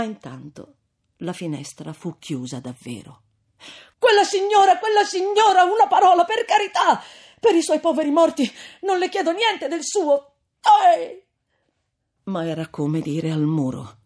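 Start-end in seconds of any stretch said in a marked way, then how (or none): 4.0-11.3 high, loud call to a stranger at a window; calling out, begging a neighbor for a word; loud desperate calling. A narrator's reading. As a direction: plead across the street. Renzo calls to a neighbor.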